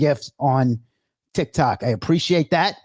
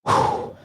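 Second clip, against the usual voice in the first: breathless exhale. Real, not acted. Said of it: "I am out of breath".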